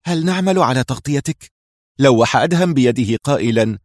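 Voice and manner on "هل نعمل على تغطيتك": higher, eager call after him; mildly raised, urgent offer. A narrator's reading. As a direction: call the question out, pitched up. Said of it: "هتف به زياد".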